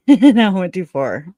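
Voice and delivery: amused, laughing repeat. Real, not acted. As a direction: giggle it out. Laughing rereads her own post.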